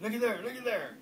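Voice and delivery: high, loud urgent pointing; excited call. Retold or imitated. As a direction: shout it like a wide-eyed spotter. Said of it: "He talked like that too".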